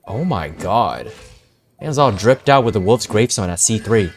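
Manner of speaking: ordinary delivery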